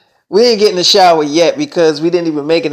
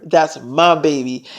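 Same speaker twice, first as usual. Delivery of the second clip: usual speaking voice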